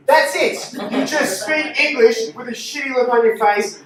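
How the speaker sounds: normal talking voice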